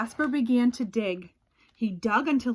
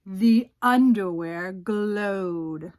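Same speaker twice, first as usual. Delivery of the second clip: spooky reveal; 3 semitones lower, 4 dB louder, much slower than usual. A narrator's reading. Spooky reveal in read-aloud.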